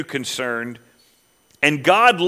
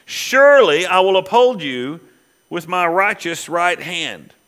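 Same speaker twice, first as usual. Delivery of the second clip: preaching scripture; 4 semitones higher than usual. Acted. Reads scripture with rising force.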